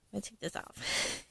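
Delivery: whispered under her breath; whispered aside. Real, not acted. Pulling a doll's hat off, a whispered aside to herself. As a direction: whisper it to yourself while fiddling. Aside while undressing doll.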